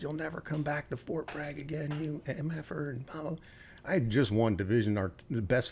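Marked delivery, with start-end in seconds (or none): 0.0-3.4 higher, hammed-up furious sergeant major; mock angry tirade. Sergeant major threatening the young lieutenant, higher mock rage. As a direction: imitate the sergeant major chewing him out.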